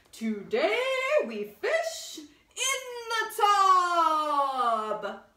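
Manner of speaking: loud, high, slow royal proclamation; booming king. A narrator's reading. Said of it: "said the king".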